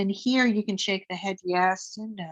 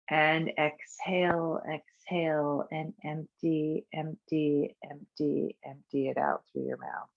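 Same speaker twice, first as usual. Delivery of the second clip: each empty softer and lower, fading out; fading exhale cue; 3 semitones lower, 6 dB quieter than usual. Shown as a demonstration. Teacher cueing the exhale, fading softer each time. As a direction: let the voice empty out with the breath. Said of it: "fading empty cue".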